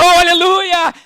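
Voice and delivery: a lightly mock fervent cry, high; fervent hallelujah. Preacher imitating fiery churchgoers praising, light mock fervent cry. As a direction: imitate an eager believer crying out. Irmãos assim, bem sanguíneos.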